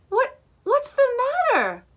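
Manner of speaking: high, lightly voiced concerned chimp; Jim asking. A narrator's reading. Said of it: "asked Jim".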